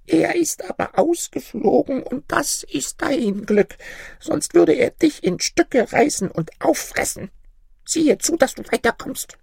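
put-on very high old woman voice; high-pitched little old granny; ancient granny warning. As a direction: warn him off in a tiny, high-pitched crone voice. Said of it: "sprach die Alte".

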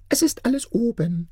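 eerie high chirp of a ring spirit. A narrator's reading. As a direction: chirp it out like a tiny beetle spirit. Spirit reports the task done.

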